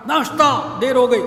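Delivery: loud, high mock yell at the wife; retold shout. Husband yelling at his wife for breakfast, loud mock shout. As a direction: bark the order like an angry husband. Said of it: "وہ چیخ کر کہتا تھا".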